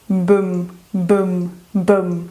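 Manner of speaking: low "bum" with a slightly stretched uh; schwa bridge. Shown as a demonstration. Teacher demonstrating the little bridge between B and M, low schwa. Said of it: "Você estende um pouquinho essa".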